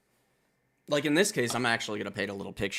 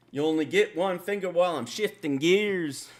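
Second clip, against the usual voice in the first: much higher, louder rap-star delivery; 11 semitones higher, 7 dB louder than usual. Retold or imitated. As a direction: spit it out big and high like a rapper hyping a crowd.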